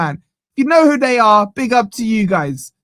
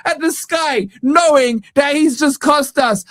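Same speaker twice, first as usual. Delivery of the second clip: ordinary talk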